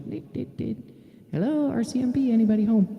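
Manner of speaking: the same police call but held back and quiet; what police should call into a house, hushed then high. Shown as a demonstration. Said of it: "that's how you do it".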